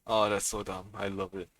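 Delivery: ordinary talk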